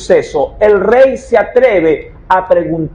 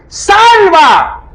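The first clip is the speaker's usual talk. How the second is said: high, loud desperate plea; desperate cry; 10 semitones higher, 3 dB louder than usual. Retold or imitated. Woman shouting down to the king on the wall, desperate high cry. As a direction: cry out for rescue from a rooftop. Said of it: "le grita al rey y le dice".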